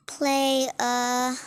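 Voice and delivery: normal talking voice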